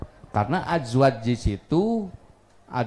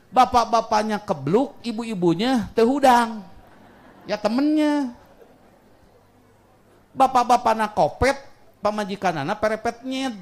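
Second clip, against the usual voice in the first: semangat bercanda; 9 semitones higher, 4 dB louder than usual. Real, not acted. Bercanda keras di depan jamaah.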